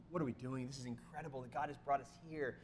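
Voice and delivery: hushed, understated wonder; tearful awe, retold. Husband to his wife at the summit, hushed awe. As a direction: say it softly, quietly moved. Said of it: "with tears in my eyes".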